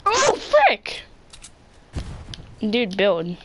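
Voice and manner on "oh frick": sudden high yelp; jolted, high-pitched cry; startled yelp. Real, not acted. Gun fight, sudden hit.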